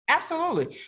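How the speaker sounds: slow, bright announcer read of the number; infomercial voice. Pretend. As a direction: read it like a TV ad pitch. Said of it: "That's my, that's my infomercial vo-, voice".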